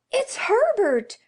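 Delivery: high, very loud scream of a name. Acted. Old woman screaming that her dead son is at the door. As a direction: scream it out with wild hope. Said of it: "she screamed".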